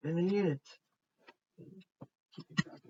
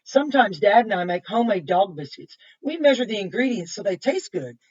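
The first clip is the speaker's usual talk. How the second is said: bright, louder storybook read-aloud; reading to children; 2 semitones higher, 8 dB louder than usual. Acted. Reading a picture book to kids, bright and lively. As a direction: read it out lively for the little ones.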